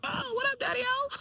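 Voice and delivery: high, sing-out hip greeting; jokey greeting. Pretend. Daughter pretends to greet her estranged father, jokey and high.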